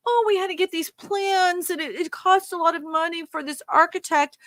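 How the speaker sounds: mock excuse. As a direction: parody a bureaucrat explaining costs. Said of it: "where's the money go".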